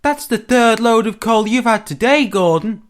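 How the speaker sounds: high, loud needling engine voice; high and pointed teasing; teasing engine James. A narrator's reading. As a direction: pipe up loudly with a high-pitched jibe.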